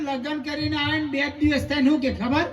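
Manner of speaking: ordinary talk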